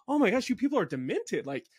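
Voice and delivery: high-pitched, mock-appalled outburst; retold exasperation. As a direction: react in exaggerated disbelief, pitch jumping up. Retold exasperated reaction to alarmists.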